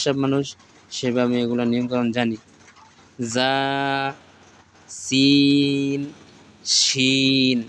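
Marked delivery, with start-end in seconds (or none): 3.3-7.6 letter names stretched long, one by one; slow, stretched Arabic letter names; drawn-out recitation. Shown as a demonstration. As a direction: draw out each letter name like a Quran teacher. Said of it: "টেনে টেনে পড়বেন".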